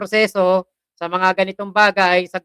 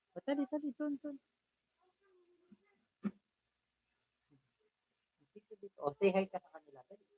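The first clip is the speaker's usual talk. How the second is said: softer, cooing to a pet; coaxing pet; 2 semitones higher, 18 dB quieter, faster than usual. Real, not acted. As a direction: gently call the pet over. (malambing na pagtawag sa alaga)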